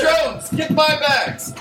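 high slow order. Acted. Boss ordering his assistant.

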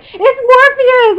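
high, loud and very drawn out; over-excited blurt. Retold or imitated. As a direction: re-enact a star-struck cry at an actor. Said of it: "I'm so excited".

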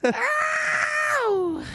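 sudden high scream of fright; startled yelp. Real, not acted. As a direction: yelp in real surprise. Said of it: "that scared me".

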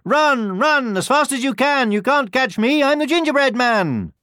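high, loud sing-out taunt; gleeful high-pitched storybook boast; taunting gingerbread man. A narrator's reading. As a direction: taunt pursuers in a high little voice. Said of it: "just laughed and said".

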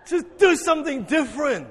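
punchy call to the congregation; emphatic urging. Real, not acted. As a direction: urge them with a big lift in pitch. Repeats request loudly after the fright.

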